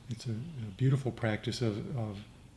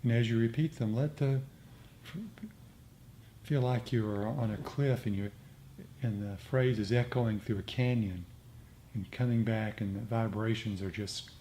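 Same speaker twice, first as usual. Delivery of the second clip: guided meditation; 5 dB quieter than usual. Acted. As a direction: lead the group gently into metta. S0 leads metta meditation.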